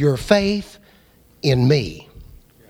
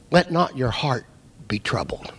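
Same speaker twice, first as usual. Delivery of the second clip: hushed comfort; 9 semitones lower, 5 dB quieter than usual. Acted. Jesus comforting anxious disciples.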